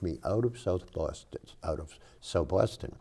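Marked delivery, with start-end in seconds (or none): none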